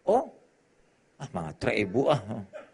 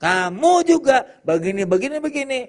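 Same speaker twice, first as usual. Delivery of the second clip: mocking retort; 10 semitones higher, 7 dB louder than usual. Quarrel retort, mimicked.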